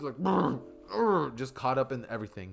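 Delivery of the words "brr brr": higher muffled noises through a gag; muffled struggling grunts. Acted. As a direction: mumble against a gagged mouth. Tied up, mouth webbed shut.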